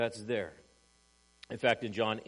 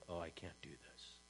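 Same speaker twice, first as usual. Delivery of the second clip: much softer, hushed and defeated; convicted groan; 7 semitones lower, 16 dB quieter than usual. Retold or imitated. Convicted accusers giving up, hushed and defeated. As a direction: let it fall away, beaten by guilt. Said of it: "these guys are, like, going".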